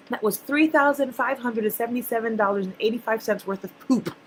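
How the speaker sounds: usual speaking voice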